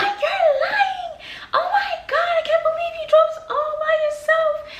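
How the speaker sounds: very high, loud, laughing disbelief; shocked mom. Retold or imitated. Mom hearing daughter drove to Orlando, squealing high disbelief. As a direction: shriek it like mom can't believe it. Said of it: "And she's like".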